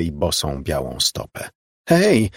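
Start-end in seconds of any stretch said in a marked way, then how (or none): 1.9-2.3 high, loud call. A narrator's reading. Margo calls after the fleeing girl, a high loud call. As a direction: shout to catch someone's attention. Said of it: "zawołała".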